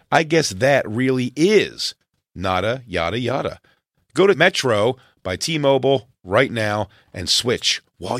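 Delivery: polished, emphatic sponsor read. Real, not acted. Podcast host reading a phone sponsor spot, polished ad delivery. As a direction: sell it clearly, lifting and landing each phrase.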